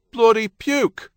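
high, loud British docker exclamation; British dock worker. A narrator's reading. Someone outside at the smell, loud British docker. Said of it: "someone else said".

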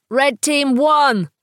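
slow, high-pitched, savage emphasis; furious declaration. A narrator's reading. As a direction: declare victory with fierce, drawn-out force. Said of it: "furiously, with a savage and inexplicable emphasis".

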